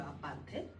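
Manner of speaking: normal talking voice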